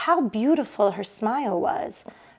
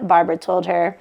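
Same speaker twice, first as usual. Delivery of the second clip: ordinary delivery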